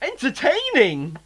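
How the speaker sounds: high, slow mock cheer; sarcastic exclaim. Real, not acted. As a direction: drag it out in fake delight.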